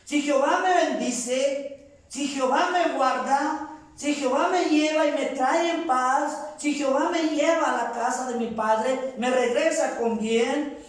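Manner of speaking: high, insistent chain of vows; preaching at full force. Real, not acted. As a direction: build the vow clause by clause, pitched high. Preacher voicing Jacob's vow.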